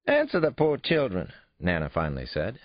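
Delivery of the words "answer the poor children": put-on high, louder old-lady demand; nosy grandmother. A narrator's reading. Grandmother demands her grandson answer the kids, scolding. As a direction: scold him to spill it.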